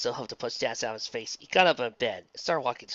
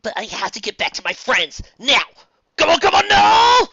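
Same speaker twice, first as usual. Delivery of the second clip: straining yell; desperate shout; 12 semitones higher, 14 dB louder than usual. Acted. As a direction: shout in frustration.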